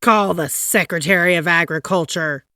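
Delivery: loud commanding call; shouted order. A narrator's reading. President shouting for the Secretary of State. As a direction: bark the order across the house. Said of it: "shouted President Taft".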